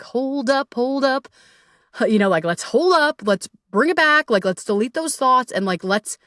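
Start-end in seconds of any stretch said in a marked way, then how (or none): 0.0-1.3 calm self-check. Retold or imitated. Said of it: "It's like".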